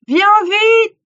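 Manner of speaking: high, very loud shout; full shout. Acted. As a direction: scream it at the top of your lungs. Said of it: "Plus fort".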